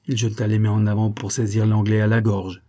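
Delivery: ordinary delivery, nothing marked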